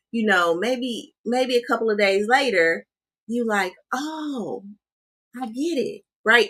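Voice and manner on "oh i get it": reflective realization. Retold or imitated. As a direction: let it dawn on you quietly days later. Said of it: "It's more reflective".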